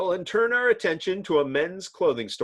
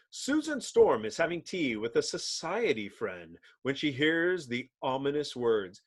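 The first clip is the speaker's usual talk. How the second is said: usual speaking voice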